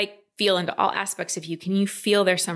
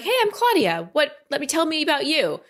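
much higher, eager hello to strangers; bright friendly greeting; 7 semitones higher than usual. Pretend. Coach acting out a confident woman greeting strangers, bright and high. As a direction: chirp it out like you're glad to meet them. Confident self-introduction at event.

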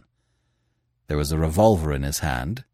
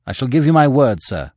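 stiff; 6 semitones higher, 5 dB louder than usual. A narrator's reading. Editor gives his word to the gunman, stiffly. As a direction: give your word stiffly, formally. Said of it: "said the editor stiffly".

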